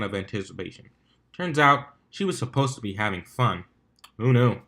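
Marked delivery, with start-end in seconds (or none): none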